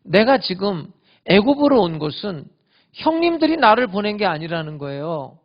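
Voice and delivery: proclaiming. Pretend. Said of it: "요셉이 기가 막힌 말을 하잖아요".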